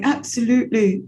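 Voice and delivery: a hint of plummy English accent; mock upper-class agreement; posh British father. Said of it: "And he's like".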